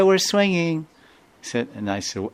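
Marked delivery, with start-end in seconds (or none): 0.0-0.9 put-on high, bright child answering cheerfully; child's voice. Children on the swing answer the visitor in a child's voice. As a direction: chirp it like a carefree little boy.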